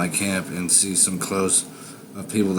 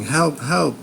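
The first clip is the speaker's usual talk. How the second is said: high, louder mock cry for help; retold victim screaming; 8 semitones higher, 4 dB louder than usual. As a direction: imitate the victim's panicked scream, pitched up. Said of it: "And they start screaming".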